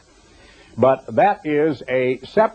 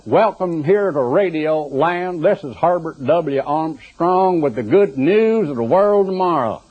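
slow, higher-pitched country-radio Southern drawl; exaggerated folksy Southern accent; 5 semitones higher, slower than usual. Preacher mimicking a howdy-y'all radio host for the congregation, exaggerated Southern accent. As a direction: put on a hammy Southern twang, drawn out. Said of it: "God didn't choose a man with that accent".

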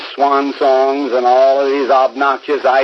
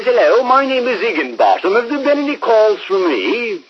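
much higher put-on caller voice; disguised voice; 9 semitones higher than usual. Prankster posing as Higginbottom on the phone, disguised high voice. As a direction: fake a polite stranger's voice. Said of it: "He disguised his voice".

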